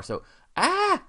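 sudden high yelp of surprise; startled yelp. Real, not acted. Teacher yelps when the screen glitches, startled high cry. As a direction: caught off guard, yelp out loud. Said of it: "Sorry, what happened there?".

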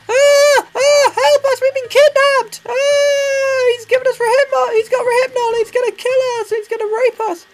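very high, loud hammed-up kidnap panic; mock screaming for help. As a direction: shriek like a captive trying to attract attention. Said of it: "'Cause they could be screaming".

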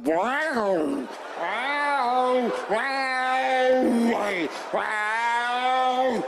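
loud, hammed-up cat yowl; mock growl like a cat eyeing a hamster. Cat warning its owner about the hamster, in a loud mock yowl. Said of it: "your cat starts going".

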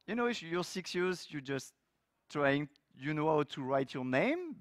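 usual speaking voice

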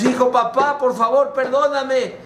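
high, urgent begging; acted tearless imploring; emotional pleading. Reunion, son begs forgiveness.

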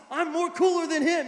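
high, loud indignant toy; mock jealous protest. Acting jealous Woody to other toys.

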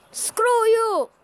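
high, drawn-out angry call; defiant outburst. Real, not acted. Player to annoying opponent, defiant outburst. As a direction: hurl it at the opponent.

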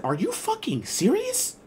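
high, slow, disbelieving quote; incredulous instructor. Retold or imitated. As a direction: squeak it out in mock disbelief. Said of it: "She said".

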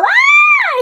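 high, loud squeal of delight; a real delighted shriek at the gift. Real, not acted. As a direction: let out a sudden shrieking Ay. Squeals at the unboxing.

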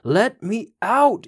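high, slow, pleading scream from inside a pocket; first desperate scream, high and drawn out; muffled scream. A narrator's reading. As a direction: howl to be let out, trapped and burning. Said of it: "he could hear Old Nick start to scream".

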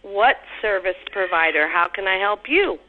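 normal talking voice

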